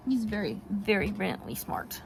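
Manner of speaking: normal talking voice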